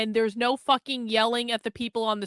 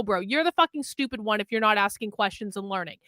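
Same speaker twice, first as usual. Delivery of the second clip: ordinary talk, nothing marked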